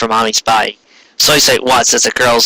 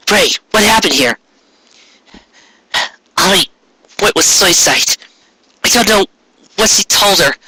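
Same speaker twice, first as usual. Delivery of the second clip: put-on higher girl's voice, upset and rising to despair; guilt-stricken Ray; 8 semitones higher than usual. Ray telling the girls it's her fault, higher upset voice. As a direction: confess it, breaking at the end.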